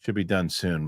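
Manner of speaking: low, soft, annoyed grumble; grudging low reply; annoyed worker brushing off customer; annoyed mutter. Retold or imitated. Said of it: "like he's annoyed".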